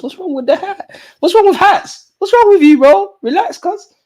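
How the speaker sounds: high, loud exasperated protest at chat; mock outrage. Real, not acted. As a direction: play up being offended by the hat jokes. Chat keeps mocking his hat.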